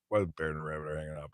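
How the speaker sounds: ordinary talk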